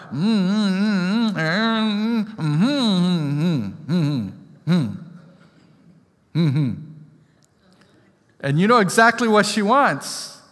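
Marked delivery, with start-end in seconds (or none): 0.1-6.8 high pleading "mm" hums; toddler's demanding hums. Retold or imitated. Father imitating his little daughter demanding, high whiny hums. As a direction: imitate a toddler insisting without words. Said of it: "they let you know what they want".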